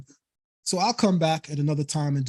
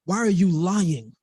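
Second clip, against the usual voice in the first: "Why are you lying?" slow, every vowel leaned on; Caribbean vowel stress; slower than usual. Shown as a demonstration. Streamer calls out a rival, every vowel stressed. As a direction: drag out each vowel of "lying" to make the point. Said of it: "we stress every vowel".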